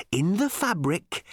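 slow, high, over-careful enunciation; slow, distinct, patient. A narrator's reading. Ford explaining to a baffled Arthur, slowly and patiently. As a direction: spell it out for a slow listener. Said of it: "as slowly and distinctly and patiently".

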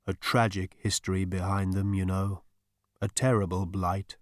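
lightly voiced soothing spirit; softly solemn, even and unhurried; deep, quiet voice. A narrator's reading. Disembodied voice telling of tragedy, deep and quiet. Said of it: "The voice was deep and quiet".

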